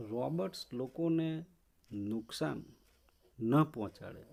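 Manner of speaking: ordinary delivery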